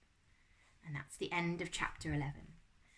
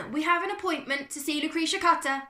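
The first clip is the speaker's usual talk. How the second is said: loud, high-pitched announcement; big character shout; 11 semitones higher, 10 dB louder than usual. A narrator's reading. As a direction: shout it to someone at the gate. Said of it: "Humphrey shouted".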